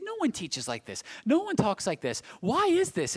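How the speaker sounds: rising to a high, loud question; baffled crowd. Acted. People of his day reacting to Jesus, baffled. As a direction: build from statement to baffled demand. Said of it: "baffled the people of his day".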